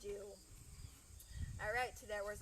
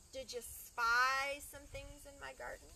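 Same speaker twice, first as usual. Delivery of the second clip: usual speaking voice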